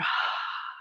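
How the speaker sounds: soft, voiceless sigh out through the mouth; demonstrated relaxing out-breath; sighing exhale. Shown as a demonstration. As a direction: let the breath fall out in a sigh. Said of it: "like a sigh".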